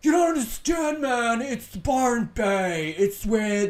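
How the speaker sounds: loud, high, insistent defensive yell; half-hammed stoner pleading it's Byron; mock Byron local. Mocking a Byron Bay local defending prices, loud insistent voice.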